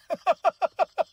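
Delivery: a burst of real laughter. Real, not acted. Vlogger laughing at his wife's teasing, real loud laugh.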